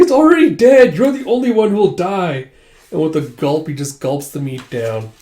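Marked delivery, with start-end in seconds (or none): 0.0-2.5 high, loud acted outburst; hammed-up indignant protest; exasperated retort. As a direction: yell the correction in exasperation.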